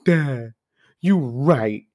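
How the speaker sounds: hammed-up whiny crying; mock crying whine. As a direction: ham up a spoiled son whining to his father. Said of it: "goes crying to his dad".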